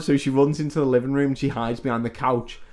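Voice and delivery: usual speaking voice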